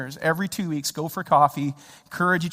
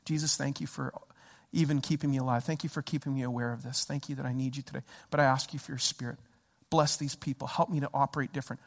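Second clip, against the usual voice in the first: low, soft, humble praying; quiet prayer; 7 semitones lower, 10 dB quieter than usual. Retold or imitated. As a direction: pray privately under the breath, calmed. Re-enacts his calming prayer.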